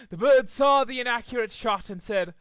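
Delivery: usual speaking voice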